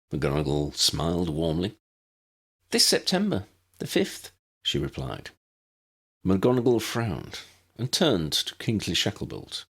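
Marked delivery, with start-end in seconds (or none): 2.7-4.3 put-on high childlike voice; a small girl answering plainly; young girl Lydia. A narrator's reading.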